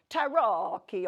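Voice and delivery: loud Italian tarocchi, hard k; attempted Italian. Shown as a demonstration. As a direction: say "tarocchi" in a big, hammed-up Italian accent with a k sound. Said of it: "in I-, Italian".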